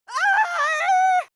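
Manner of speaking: sharp high scream of pain; sudden high-pitched yelp. Acted. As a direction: let out a shrill cry as you're hit. Character cries out in pain.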